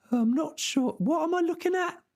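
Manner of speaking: high, baffled peasant; confused peasant. Acted. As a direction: ask it high and puzzled. Sancho puzzled.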